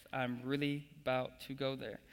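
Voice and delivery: usual speaking voice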